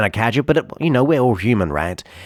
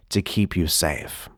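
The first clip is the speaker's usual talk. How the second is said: normal talking voice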